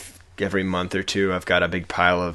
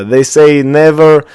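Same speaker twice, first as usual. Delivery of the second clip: put-on amateur Russian accent, loud and deliberate; put-on Russian accent, raised voice; 3 semitones higher, 9 dB louder than usual. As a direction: declaim it in a rough Russian accent. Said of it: "I don't know how to do a Russian accent".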